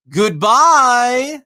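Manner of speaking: high, loud, drawn-out mock farewell; pointed goodbye. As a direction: sing it out to demand an answer. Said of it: "When I say goodbye to someone and I don't get a".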